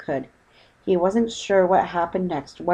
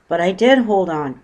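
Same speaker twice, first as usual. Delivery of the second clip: louder protest, DID stressed; indignant cry; 7 dB louder than usual. Acted. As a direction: sputter back indignantly. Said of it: "cried Paddington".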